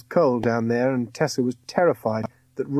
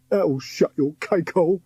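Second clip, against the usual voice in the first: sniggering; 5 semitones higher than usual. A narrator's reading. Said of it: "De Sniff sniggered".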